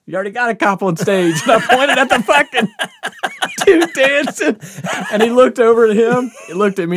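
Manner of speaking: can't hold back the laugh as you tell it. Real, not acted. Recounting his cop joke to a friend, laughing hard. Laughing while retelling the comeback.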